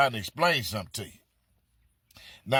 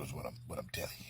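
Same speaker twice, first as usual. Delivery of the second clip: whispered aside; 10 semitones lower, 15 dB quieter, much faster than usual. Real, not acted. Confiding aside.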